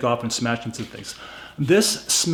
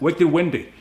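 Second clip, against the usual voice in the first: Boston accent, louder and slightly higher; 3 semitones higher, 4 dB louder than usual. Shown as a demonstration. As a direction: lean into a thick Boston accent. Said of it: "my Boston accent".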